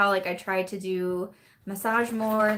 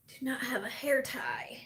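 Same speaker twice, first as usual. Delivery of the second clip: breathy hushed whisper; 4 semitones higher, 7 dB quieter than usual. Real, not acted. Whispering to viewers about the missing hair tie. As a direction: whisper it like a small confession.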